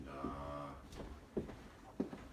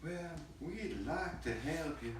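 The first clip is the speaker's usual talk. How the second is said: southern twang; 5 semitones higher than usual. Acted. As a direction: stretch it into a swooping Southern twang. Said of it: "like, a southern twang now".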